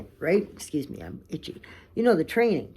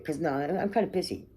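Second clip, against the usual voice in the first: ordinary talk, nothing marked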